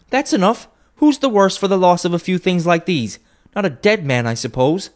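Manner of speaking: very high, loud cry; woman crying out. A narrator's reading. First woman crying out to the others, very high and loud. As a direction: fling it out boldly.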